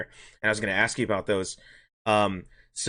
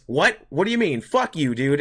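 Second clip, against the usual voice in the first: mock outraged outburst, higher and louder; imitating an angry customer; 5 semitones higher, 5 dB louder than usual. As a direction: act out the customer blowing up. Customer erupts at the bill.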